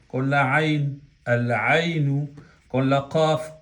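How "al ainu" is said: "al ainu" slow, L sounded before the ain; lam pronounced. Shown as a demonstration. Teacher demonstrating the article before ain, slowly pronounced lam. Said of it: "hemos pronunciado la lam".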